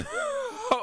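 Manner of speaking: squealing laugh, way up high; shrieking laughter; high-pitched laugh. Real, not acted. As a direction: crack up helplessly. Cracking up at impression.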